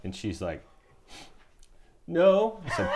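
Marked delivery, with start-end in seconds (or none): 2.1-2.6 put-on high-pitched kid saying no; child's refusal. Father imitating his child refusing dinner, high whine. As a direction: imitate a stubborn little girl, high.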